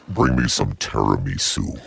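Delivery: very deep, slow order. Pretend. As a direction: drop it way down and slow.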